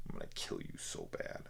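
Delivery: low, whispered sinister threat; whispered mock threat. Reviewer repeating the bad line, whispered mock menace. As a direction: hiss it low like a creepy villain.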